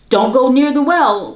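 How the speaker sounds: loud warning call. A narrator's reading. Mother warning her sons by the well, loud and firm. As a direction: warn the boys away from danger. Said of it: "warned the mother".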